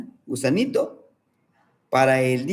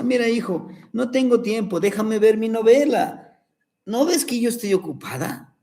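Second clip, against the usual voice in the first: impatient mother; 6 semitones higher than usual. Acted. Busy mother brushing son off.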